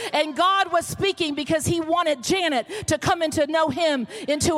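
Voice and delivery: high, driving sermon climax; impassioned preaching. Real, not acted. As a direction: preach it out to the whole church with rising force. Preaches to the congregation at full force.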